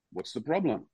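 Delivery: quiet, unbothered ask; calm mild question. Retold or imitated. Coach unbothered by teammates yelling, quietly calm. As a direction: shrug it off softly as the coach. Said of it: "And he goes".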